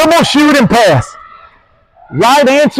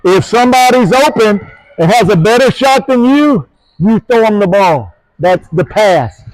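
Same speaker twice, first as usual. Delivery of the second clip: ordinary talk, nothing marked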